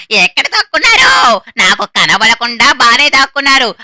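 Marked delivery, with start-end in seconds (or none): 0.1-1.4 angry shout. Acted. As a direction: bellow it, losing patience. Killer yelling for hiders.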